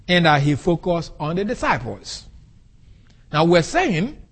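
normal talking voice

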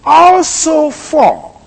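"Also for" lifted high and drawn out slowly; emphatic rendering. Shown as a demonstration. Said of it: "translated something like this".

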